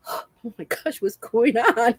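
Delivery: softly gasped mock panic; retold shaken disbelief. Driver after the tailgater took off, hushed mock panic. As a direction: gasp first, then say it softly, laughing after. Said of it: "I was like".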